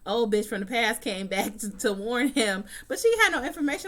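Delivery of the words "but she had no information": high, loud, fast and exasperated; exasperated outburst. Real, not acted. To the ex who came from Korea, a high, fast, exasperated outburst. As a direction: fire it off in disbelief at the ex. Ranting about the ex's visit.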